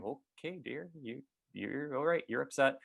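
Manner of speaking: calm him down gently; soothing. Retold or imitated. Wife soothing her upset husband, gentle.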